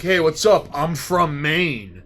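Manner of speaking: loud, slow showy greeting, drawing out the last words; loud mocking boast, stretched out; mock loud bragging tourist. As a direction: ham up a proud out-of-towner announcing himself. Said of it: "and be like".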